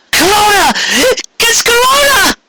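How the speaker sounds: a pony screaming in fear; panicked crowd cry. Acted. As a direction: cry her name out in terror. Terrified crowd cries her name.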